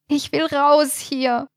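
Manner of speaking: put-on high, slow childish whine; whining scared toddler. A narrator's reading. Marlies begging her friends to leave, whining like a scared toddler. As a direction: whimper it like a frightened little child. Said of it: "quengelte sie wie ein verängstigtes Kleinkind".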